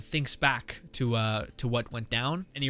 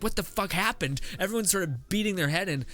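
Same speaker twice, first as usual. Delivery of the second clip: mildly acted alarm, pitched higher; alarmed retelling; 7 semitones higher than usual. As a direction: voice a shocked character running up. Demon boy runs in, alarmed.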